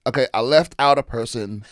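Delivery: normal talking voice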